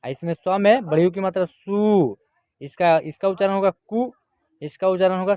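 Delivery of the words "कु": कु said quickly with a brief vowel; short, unstretched कु. Shown as a demonstration. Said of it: "इसका उच्चारण जो है जल्दी".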